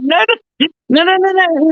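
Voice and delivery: high, repeated imitated no-no-no; retold hasty denial. Director hastily correcting her reading of the character, dismissively.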